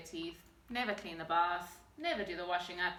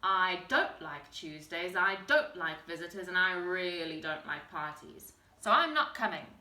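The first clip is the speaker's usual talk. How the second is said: grumpy troll, louder and stubborn; grumpy troll growl; 4 semitones lower, 6 dB louder than usual. A narrator's reading. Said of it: "growled the Grunt".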